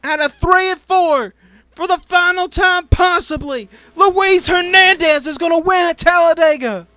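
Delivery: high, loud finish-line play-by-play; excited final-lap call. Real, not acted. Commentator calling Luis Hernandez to win at Talladega, excited. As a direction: shout the likely winner home.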